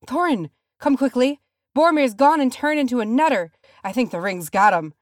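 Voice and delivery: high, loud urgent cry; frantic alarm. A narrator's reading. Dwarf warning his leader of a madman, frantic. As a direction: raise the alarm in a panic. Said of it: "his eyes wild".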